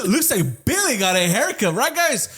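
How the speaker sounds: high, drawn-out rallying taunt; classroom call-out. Retold or imitated. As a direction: get the whole class chanting along. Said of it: "Everybody fucking starts chanting and shit".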